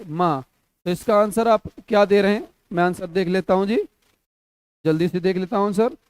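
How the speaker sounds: normal talking voice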